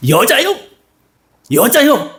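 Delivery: high, mocking snap at the woman; mocking retort. Peter mocking the servant girl, pitched high. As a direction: brush her off with a sneer. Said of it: "확 놀리는 거예요".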